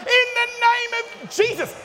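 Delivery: high, loud preaching cry; loud high revival shout; fervent shout. Acted. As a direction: cry it out at full force. Climax of fervent prayer.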